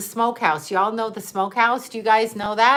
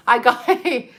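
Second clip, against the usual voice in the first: laughing; 4 semitones higher than usual. Real, not acted. Giggles while reading the text.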